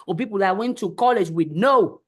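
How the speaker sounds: ordinary talk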